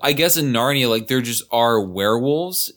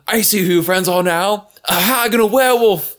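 raised, rising accusation; accusing; 9 semitones higher, 5 dB louder than usual. Retold or imitated. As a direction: call them out sharply. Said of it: "Caspian's like".

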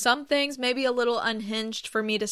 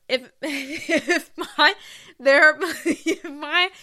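laughing; 7 semitones higher, 7 dB louder, much slower than usual. Real, not acted. Laughs mid-sentence.

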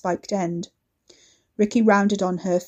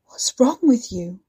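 ordinary delivery

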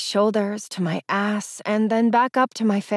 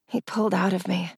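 ordinary delivery

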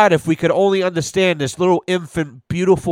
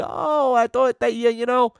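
mock flustered voice; 9 semitones higher than usual. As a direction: squeak it out like someone caught out. Mocking flustered heat complainer.

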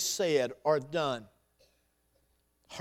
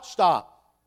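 loud, sharp command; firm stop; 9 dB louder than usual. Real, not acted. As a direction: cut them off hard. Pastor cuts off the excuse.

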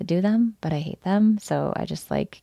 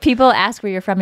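ordinary talk, nothing marked

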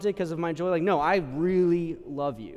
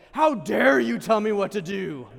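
louder, slow mock outrage; indignant; 5 semitones higher, 7 dB louder than usual. Patient snapping at the doctor, slow hammed-up outrage. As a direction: bristle at the dentist's advice. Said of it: "How many of you are like".

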